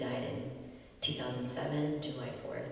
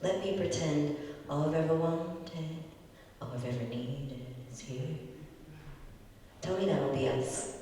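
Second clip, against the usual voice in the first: louder, earnest spoken-word delivery; open mic poet; 5 dB louder than usual. Acted. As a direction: perform it like an open mic set. Poet performs poem after intro.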